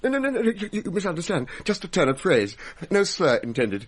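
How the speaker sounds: high, hurried protest; panicked denial. Acted. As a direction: scramble to take it back. Hastily denying to ghost.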